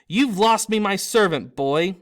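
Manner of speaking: a nobleman losing his temper; enraged master shouting at the boy; furious Malfoy. Acted.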